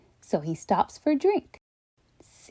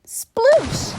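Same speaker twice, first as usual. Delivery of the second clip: splash sound effect; 14 semitones higher, 4 dB louder than usual. Acted. Steve falls in water.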